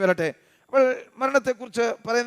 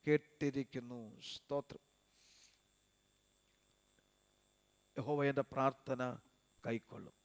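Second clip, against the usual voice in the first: lower, softer, intimate sermon voice; soft pastoral exhortation; 6 semitones lower, 11 dB quieter than usual. Acted. Pastor reassuring the gathered believers, soft and low. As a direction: bring it down and comfort the faithful.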